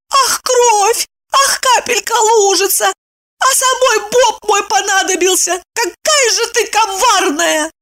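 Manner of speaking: high, mocking coo; mocking mimicry. A narrator's reading. Evdokia mocks her friend's tale, mimicking in high pitch. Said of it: "передразнила подругу".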